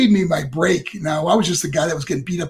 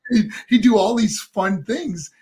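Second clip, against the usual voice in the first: usual speaking voice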